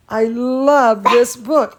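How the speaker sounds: plain reading voice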